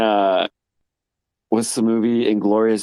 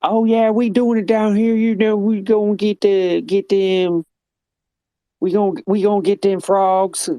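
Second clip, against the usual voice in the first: mock Louisiana accent; 11 semitones higher than usual. Mocking how Louisiana folks talk, Cajun accent. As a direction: imitate a thick Louisiana Cajun voice. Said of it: "I can barely understand them half of the time".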